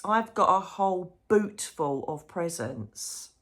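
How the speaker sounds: usual speaking voice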